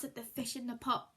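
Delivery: usual speaking voice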